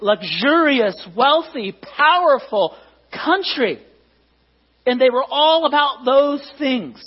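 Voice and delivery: high, loud, emphatic sermon delivery; forceful preacher, slowing on key words; impassioned preaching. Real, not acted. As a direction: drive each phrase home to the congregation.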